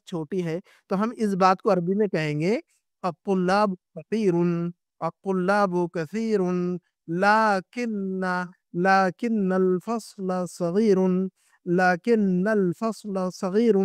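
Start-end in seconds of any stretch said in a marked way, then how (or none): 3.0-13.8 الطلاب کثیر read very slowly, word by word, with long pauses; slow model reading. Shown as a demonstration. Teacher modelling the sentence for students to repeat, very slow. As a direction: spell out الطلاب کثیر لیکن الفصل صغیر slowly so students can copy it. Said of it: "اس جملے کو پڑھیے گا آپ لوگ".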